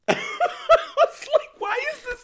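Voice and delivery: teary laugh retelling the moment; laughing through tears. Retold or imitated. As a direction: squeak it out laughing and moved.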